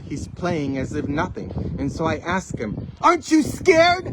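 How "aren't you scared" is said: high, loud incredulous question; acted alarmed question. Asks boy during the storm.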